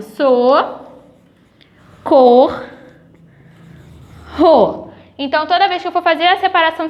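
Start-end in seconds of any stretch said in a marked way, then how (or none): 0.0-4.9 so, cor, ro said as separate slow beats; syllable-split demo. Shown as a demonstration. Teacher showing the rr split, one syllable at a time. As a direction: break socorro into so-cor-ro. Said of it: "Por exemplo".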